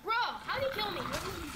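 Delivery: can't believe he died; surprised outrage. Real, not acted. Just got killed.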